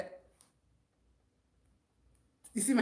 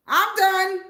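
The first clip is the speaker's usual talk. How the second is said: playful sign-off; 7 semitones higher, 7 dB louder than usual. Real, not acted. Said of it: "Y'all don't wanna hear me talk".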